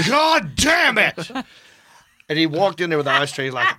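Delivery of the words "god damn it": high, stretched-out enraged holler; hammed-up screaming curse; mock furious shout. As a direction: bellow it slowly like a raging Texan roommate. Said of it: "he got really mad".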